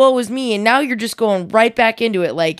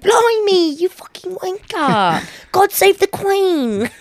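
high, slow hammed-up British accent; mock cockney British; 9 semitones higher, much slower than usual. Joking about Brits, in a high, slow mock British accent. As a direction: drawl it like a cartoon Brit.

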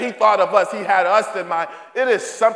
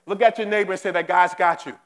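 normal talking voice

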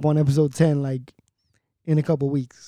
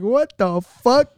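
shocked outburst; 7 semitones higher, 5 dB louder, much slower than usual. Retold or imitated. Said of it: "I was like".

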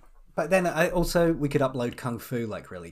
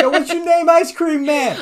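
loud, high mock call out the window; 11 dB louder than usual. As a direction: holler to the ice cream man. Calling out to the truck driver.

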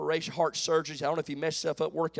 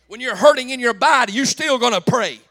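loud, high, rhythmic preaching; full-force preaching; 8 semitones higher, 12 dB louder than usual. Real, not acted. Preacher building to a shout for the congregation. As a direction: whoop it up to the rafters.

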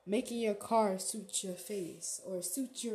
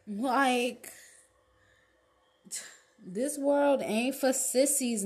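louder, pushing tough talk; loud hype; 3 semitones higher, 7 dB louder, slower than usual. Real, not acted. Pushing a viewer to toughen up, loud hype. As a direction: shout the tough truth.

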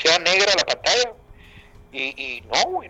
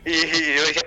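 ordinary talk, nothing marked

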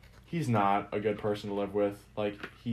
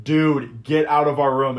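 retold order; 2 semitones higher, 9 dB louder, slower than usual. Kicking drunk intruder out.

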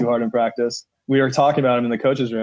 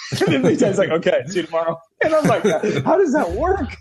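much higher, giggling all through; laughing while talking; 9 semitones higher than usual. Real, not acted. Finishing the coach story for the hosts, laughing. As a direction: laugh your way to the punchline.

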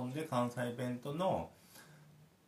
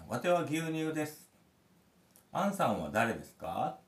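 Kansai 'wate' and 'ansan' with polite desu, slightly raised; mock Kansai dialect; 8 semitones higher, 5 dB louder than usual. Dad voicing the milk in the bedtime game, mock Kansai. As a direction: lightly play the Kansai-speaking milk. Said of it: "関西弁で言うのがポイント".

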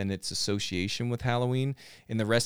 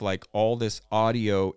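usual speaking voice

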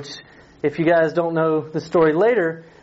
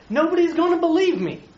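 much higher whining protest; high-pitched complaining excuse; whiny excuse; 10 semitones higher than usual. Acted. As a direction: whine it like a nervous kid. Moses making another excuse.

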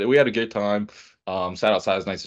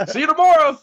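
very high, loud parting call; mock cheery send-off; 7 dB louder than usual. Dad leaving family on Father's Day, mock cheery holler. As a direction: holler a joking goodbye on the way out.

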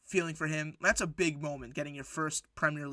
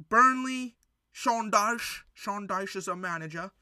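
put-on failed English accent; 4 semitones higher, 4 dB louder than usual. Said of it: "Can't do an accent. I'm sorry".

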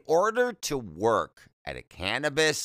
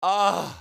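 swooning ugh; 8 semitones higher, 4 dB louder than usual. Real, not acted. Swooning over a hot guy.

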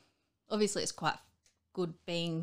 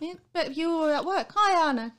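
high loud mock back and forth; 10 semitones higher, 8 dB louder than usual. Husband and wife exchange acted.

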